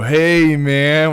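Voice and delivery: high, loud laid-back stoner voice; stoner greeting. Retold or imitated. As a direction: drawl it like a surfer stoner. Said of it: "Mojo Rawley would always go".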